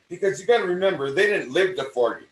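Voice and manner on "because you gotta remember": usual speaking voice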